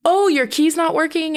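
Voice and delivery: high-pitched, booming clerk impression; mock loud clerk. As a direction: imitate an oblivious clerk announcing it to the lobby. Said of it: "He's talking really loud".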